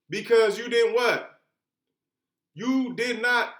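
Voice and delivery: slow, loud, each word stressed; emphatic quiz. Shown as a demonstration. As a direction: drill the point home slowly. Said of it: "quizzing the students".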